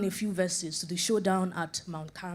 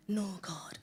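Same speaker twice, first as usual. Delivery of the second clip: whispered refusal; 3 semitones lower, 8 dB quieter than usual. Pretend. Congregant refusing God, whispered.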